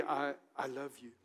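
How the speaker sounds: lightly voiced tender Jesus; gentle voice. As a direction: say it like a quiet promise. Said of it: "in that gentle voice".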